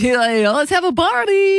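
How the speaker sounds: high, hammed-up Russian impression; squealed cartoon Russian; mock Russian accent. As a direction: imitate a rowdy Russian party-goer. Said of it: "He's Russian".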